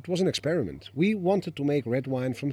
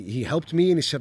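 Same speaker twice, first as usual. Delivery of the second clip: ordinary talk, nothing marked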